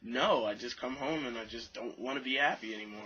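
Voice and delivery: ordinary talk, nothing marked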